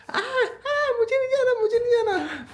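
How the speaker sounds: put-on high, wailing child voice; high whiny toddler crying not to go; imitating whining child. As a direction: play the little girl refusing to leave. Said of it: "तो आनिया ऐसे लग".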